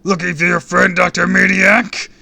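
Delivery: loud, high taunting shout; crying out. A narrator's reading. Villain taunting the helpless kid, shouting. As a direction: taunt at full volume, dragging the name. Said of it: "the rage cried".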